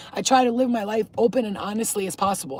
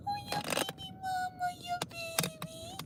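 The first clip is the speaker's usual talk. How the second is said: tiny cooing; 14 dB quieter than usual. Real, not acted. Cat lover fussing over stray cats, tiny falsetto coo.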